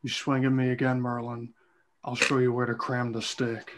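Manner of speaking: a hint of Cage's menace, quietly; Nicolas Cage voice. Mario threatening the old wizard, in a lightly done Nicolas Cage voice. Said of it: "you're still gonna do the Nicolas Cage voice".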